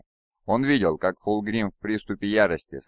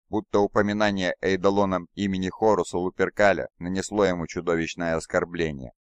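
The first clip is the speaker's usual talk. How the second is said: plain reading voice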